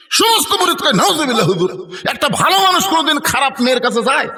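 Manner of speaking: high-pitched scandalised outcry; shocked protest. Retold or imitated. Shocked disciples object to their master's suggestion, high scandalised protest. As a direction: protest in acted disbelief, pitch jumping up.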